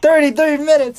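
can't believe how long it ran; amazed. Real, not acted. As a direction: announce it like a shock. Repeating recording length amazed.

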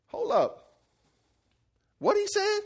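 mock disbelief, voice shooting up on the question; incredulous double take. Young David reacts to the giant's taunts, incredulous. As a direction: stop short, then question it in high-pitched disbelief. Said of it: "when somebody says something stupid and you're like".

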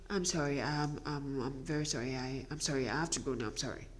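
very soft, low, stumbling sorry; meek apology. Acted. Wife excusing herself after being screamed at, meek and halting. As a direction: shrink into halting, hushed apologies. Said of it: "and you just go".

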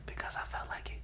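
soft unvoiced whisper. Real, not acted. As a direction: keep whispering, playful. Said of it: "Why are you whispering?".

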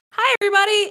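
very high, bubbly greeting; perky American consulate man saying hi. Retold or imitated. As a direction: chirp it like an overly cheerful official. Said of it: "this perky American".

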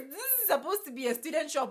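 high, loud, indignant; outraged disbelief. Retold or imitated. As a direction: protest the prices in disbelief. Outraged at shop prices.

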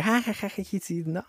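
high, breathy embarrassed giggle laughed off; awkward laugh. Retold or imitated. Girl caught taking the jar, high embarrassed giggle. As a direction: giggle nervously to brush it aside.

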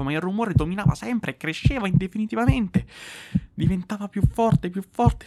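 a guilty man losing his grip; rising panic. A narrator's reading.

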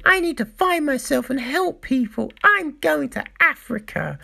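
mocking Eve. Reviewer mocks a character's noble speech in a whiny voice. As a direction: parody her as self-righteous. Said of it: "And fucking Eve going".

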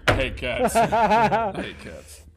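I HATE cats, stretched slowly; drawn-out I hate cats. Real, not acted. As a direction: drag out "I hate cats" with disgust. Emphatic cat hate, drawn out.

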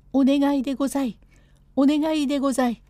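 high, fast petitioning cry; calling out. Acted. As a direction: call out the plea urgently. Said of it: "呼ばわりながら".

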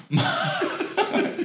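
squealing high giggle; high-pitched laughing fit. Real, not acted. Admitting to friends his talk was funny, high squealing laugh.

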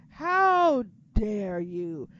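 imitating a slow, loud, drawn-out scold; mock outrage. Retelling how they wasted Neville, slow mock outrage. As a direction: stretch it out like scolding the bookers. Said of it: "I'm like".

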